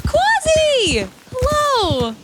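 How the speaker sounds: gasp, then high, loud excited hello; delighted greeting. Real, not acted. Streamer greeting a viewer who just arrived, delighted. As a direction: burst out greeting a friend, pitched right up.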